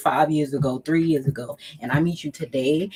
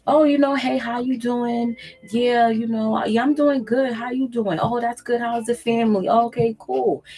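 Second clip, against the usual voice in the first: higher, sweetly friendly catch-up chatter; slow, nice tone; 7 semitones higher than usual. Shown as a demonstration. To an ex calling back, a higher, sweet, friendly tone. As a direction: keep it light and pleasant, no edge. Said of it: "in a slow, nice tone".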